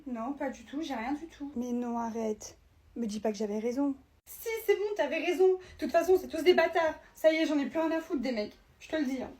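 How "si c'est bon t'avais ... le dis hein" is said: high, tearful outburst. Acted. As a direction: wail that you are done with men. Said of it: "pleure pas, tu t'en fous". (au bord des larmes, voix aiguë)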